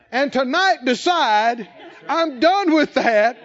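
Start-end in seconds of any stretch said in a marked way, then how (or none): 2.1-3.4 slow, loud, firm vow; resolute declaration. Pretend. Preacher voicing a believer's slow, loud vow of trust. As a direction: declare it like a turning point. Imagined decision, declared slowly.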